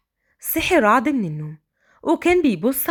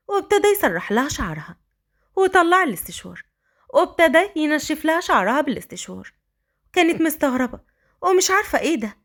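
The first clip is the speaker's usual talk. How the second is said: usual speaking voice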